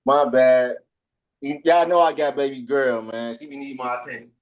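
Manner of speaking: normal talking voice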